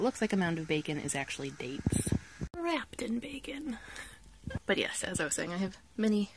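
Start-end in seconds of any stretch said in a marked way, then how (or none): 2.6-3.8 slow breathy whisper; whispered aside. Real, not acted. Whispering an aside to the viewers about breakfast. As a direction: drop to a secret whisper.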